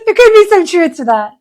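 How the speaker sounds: amused, laughing it off. Real, not acted. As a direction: break into laughter mid-line. Host laughing at kids' remark.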